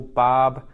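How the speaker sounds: "barb" loud and alone; first syllable. Shown as a demonstration. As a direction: punch "barb" as one syllable. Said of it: "we take the first syllable".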